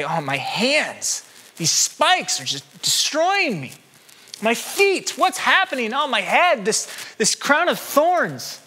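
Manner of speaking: high, slow, hammed-up whining about the pain; whiny exaggerated agony; mock pained complaint. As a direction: overplay the moaning over each wound. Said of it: "He doesn't say".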